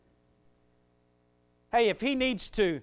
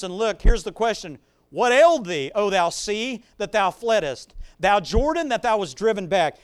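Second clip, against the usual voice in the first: louder, rising preaching voice; 4 semitones higher, 7 dB louder than usual. Acted. Preacher proclaiming the psalm to his congregation, rousing and loud. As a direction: build the psalm with fervor.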